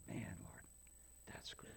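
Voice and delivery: soft whispered awe; hushed amazed prayer; awed whisper. Retold or imitated. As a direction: whisper it in wonder to the Lord. Retelling his own awed prayer.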